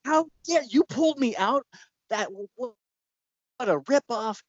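high-pitched mock outrage; outraged complaint. Woman pulled from the haunt berates her rescuer, outraged.

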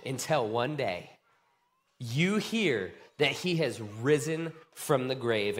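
normal talking voice

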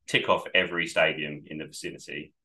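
normal talking voice

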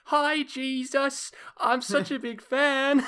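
put-on high, slow little-kid voice; squeaky child gushing at a hero; high child fan voice, drawn out; kid meme voice. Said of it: "[name] saying".

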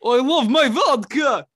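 slow, loud hammed-up Russian accent; mock Russian accent. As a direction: drawl it like a joke Russian boozer. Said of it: "I will talk in the Russian accent".

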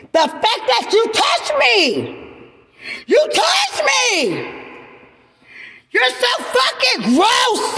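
high, shrieking rage, words stretched out; furious screaming. Real, not acted. Woman screams at the man who touched her, furious shriek. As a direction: scream it at him with everything you've got.

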